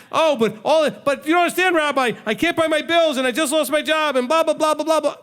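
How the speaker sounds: hammed-up whining congregant, protesting to the rabbi; whiny complainer. Congregant complaining to the rabbi about bills, mock whine.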